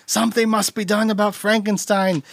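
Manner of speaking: a hint of an outraged townsperson; mock villager. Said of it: "town hall meeting where they're like".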